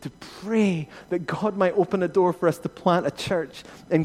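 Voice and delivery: loud, fast, driving sermon delivery; rousing, loud pulpit cadence; full-force preaching. Acted. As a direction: preach it out hard to the whole hall.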